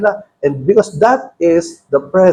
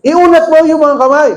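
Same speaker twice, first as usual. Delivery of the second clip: higher, louder mock command; commanding Jesus; 7 semitones higher, 4 dB louder than usual. Pastor retelling Jesus commanding the man, raised voice. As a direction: give the order with force. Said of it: "Ang sabi ni Jesus".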